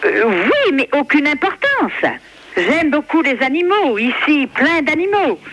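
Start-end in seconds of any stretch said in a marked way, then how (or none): none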